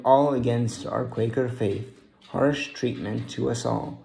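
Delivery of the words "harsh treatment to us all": plain reading voice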